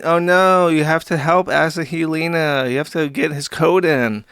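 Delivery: put-on pleading alarm; mock distress. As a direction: whine like overwrought supporters begging. Mocking the online supporters' pleas.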